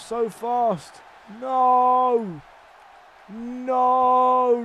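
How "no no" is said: long, drawn-out wail of no; high, loud protest held on the vowel; dismayed shout. Real, not acted. Player watching Lesnar catch up, dismayed drawn-out shout. Dismayed as opponent fights back.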